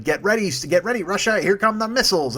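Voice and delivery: sing-song the threat like a playground bully; mock Trump taunt. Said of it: "and he's like".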